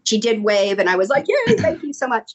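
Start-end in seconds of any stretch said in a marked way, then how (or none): 1.3-2.3 high fan-like yay; high-pitched, mock-giddy gratitude; retold giddy thanks. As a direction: imitate gushing thanks from across a room. Acts out own reply to wave.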